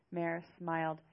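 ordinary delivery

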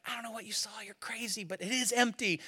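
imitated hushed back-and-forth, very soft; hushed disbelief. Peter answering the women in hiding, hushed. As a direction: dismiss it under your breath.

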